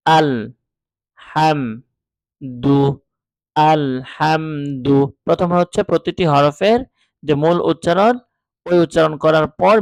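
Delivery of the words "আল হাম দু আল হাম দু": আল, হাম, দু said apart, then আল হাম দু joined slowly; each syllable alone, then drawn together. Shown as a demonstration. Teacher reads the syllables directly without spelling. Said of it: "আমি সরাসরি পড়ব হচ্ছে".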